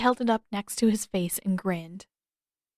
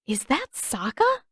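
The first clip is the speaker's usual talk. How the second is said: stunned disbelief; 6 semitones higher than usual. Acted. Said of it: "Katara's jaw dropped".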